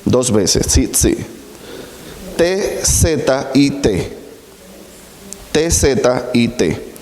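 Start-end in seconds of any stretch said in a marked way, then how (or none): 2.4-6.9 letter by letter, T Z I T, slow; slow spelled-out letters; spelling it out slowly. Shown as a demonstration. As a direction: spell each letter deliberately for the class. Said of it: "Tzitzí se escribe T Z".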